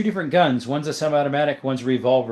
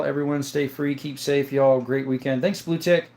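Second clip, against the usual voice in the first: usual speaking voice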